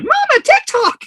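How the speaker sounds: put-on high, loud child's call; a kid's eager high shout; excited little kid. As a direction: call out like a five-year-old pointing. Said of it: "the little kid is still back there".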